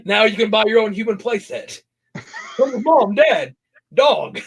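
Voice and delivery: high-pitched mock ad pitch; cheesy infomercial. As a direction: sell it like a hammy TV announcer, voice lifted. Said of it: "cheesy infomercials with monsters".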